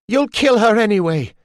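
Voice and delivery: high, loud desperate cry; a mother's despair breaking through. A narrator's reading. Molly shouting that they will kill her baby, voice breaking. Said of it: "her voice breaking on the words".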